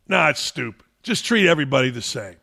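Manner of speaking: ordinary delivery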